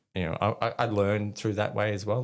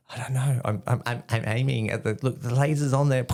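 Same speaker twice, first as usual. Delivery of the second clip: higher, stammering recruit's protest; flustered protest; 6 semitones higher, 5 dB louder than usual. Retold or imitated. Recruit answers a screaming corporal, flustered protest. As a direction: plead your case, tripping over words.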